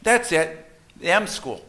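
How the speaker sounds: put-on high, loud delighted kid; boy thrilled his parents finally understand; excited child. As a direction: burst out like a child who got it right. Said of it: "He says".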